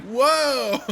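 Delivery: high loud mock-startled whoa; exaggerated whoa. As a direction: yelp it in fake shock. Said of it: "and I'm like".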